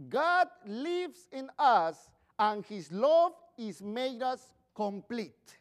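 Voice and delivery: slow, measured reading of a Bible passage; scripture reading. Acted. Pastor reading scripture to his congregation, slow and measured. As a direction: read each phrase deliberately to the congregation.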